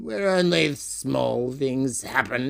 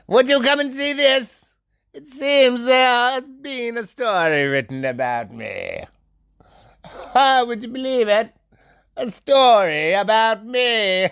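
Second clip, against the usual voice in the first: excited boasting; 7 semitones higher, 4 dB louder than usual. Acted. Excited character announcing a story.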